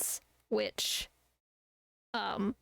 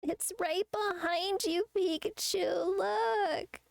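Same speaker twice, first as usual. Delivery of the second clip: high, slow drawn-out call; playful calling out; 10 semitones higher, slower than usual. Pretend. Streamer pretending to warn Pikachu what's behind, high drawn-out call. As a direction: stretch the name out way up high.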